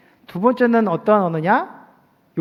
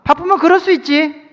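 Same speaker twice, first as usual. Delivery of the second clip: 바쁘면 그럴 수 있지 said higher and louder, snappy; wrong sharp tone; 7 semitones higher, 6 dB louder than usual. Shown as a demonstration. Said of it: "야, 아니에요". (높고 큰 목소리로 쏘아붙이듯)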